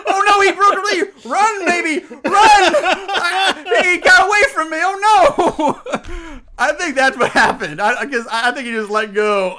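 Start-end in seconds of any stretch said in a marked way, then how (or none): 0.1-6.4 high, loud, hammy mock alarm; fake panic. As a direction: ham up a fake cry of alarm.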